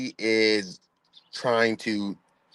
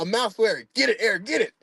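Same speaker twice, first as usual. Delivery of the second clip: frantic voice; 11 semitones higher than usual. Retold or imitated. Frantic exchange with dad.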